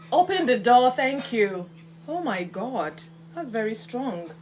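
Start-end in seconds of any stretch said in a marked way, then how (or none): 0.1-1.7 calling out. Real, not acted. Asking kitchen boy to air room.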